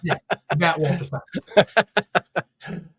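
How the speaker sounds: slow, laughing through the name. Real, not acted. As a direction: savor the name while chuckling. Laughing at famous rejection.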